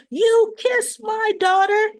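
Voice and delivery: high, loud, slow mock yell; furious accusation. Nina yelling at Drew after slugging him. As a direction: hammer each word, shrill with rage. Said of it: "she's saying".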